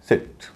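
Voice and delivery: सिट with a short i; short i sound. Shown as a demonstration. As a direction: say सिट with a brief "ih" vowel. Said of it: "उच्चारण हो रहा ई".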